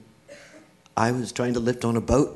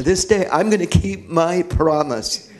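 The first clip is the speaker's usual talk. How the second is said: real heartfelt resolve, voice lifted; impassioned vow; 5 semitones higher, 5 dB louder than usual. Real, not acted. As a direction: build to a firm, ringing pledge. Vows to keep promise, impassioned.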